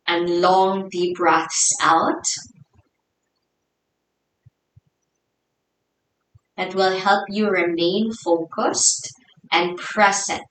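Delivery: soft, hushed meditation guidance with long pauses; guided meditation. Real, not acted. Yoga teacher settling the class into their breath, soft guided meditation. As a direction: ease them inwards, softly and unhurried.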